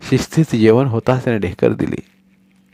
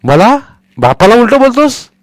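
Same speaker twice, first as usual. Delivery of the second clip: outraged shout; 14 semitones higher, 9 dB louder than usual. Acted. Father outraged at son.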